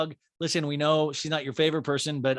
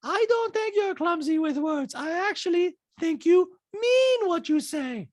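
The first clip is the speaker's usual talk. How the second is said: high, drawn-out mimicking voice; Diana; 3 dB louder, slower than usual. Diana changing her mind about Sutton, high slow impression. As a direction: imitate her high and deliberate. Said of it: "And Diana goes".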